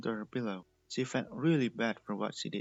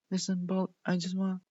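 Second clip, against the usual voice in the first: higher, louder eager appeal; urgent plea; 7 semitones higher, 5 dB louder than usual. A narrator's reading. Candy begging Prism to listen, urgent. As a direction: rush to explain.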